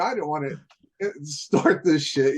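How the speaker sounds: usual speaking voice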